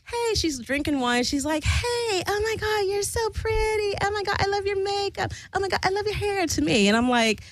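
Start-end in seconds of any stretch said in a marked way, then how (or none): 0.1-0.3 put-on high-pitched gushing drunk girl; high, over-friendly drunk girl voice. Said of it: "Drunk girl walks over and she's like". 1.6-6.4 put-on high-pitched gushing drunk girl; high, over-friendly drunk girl voice. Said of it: "I call her drunk girl".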